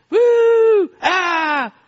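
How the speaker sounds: high, loud whoop, then a high "ah"; imitated play screams. Sons waking dad with their noise, imitated high screaming. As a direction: mimic kids shrieking at play.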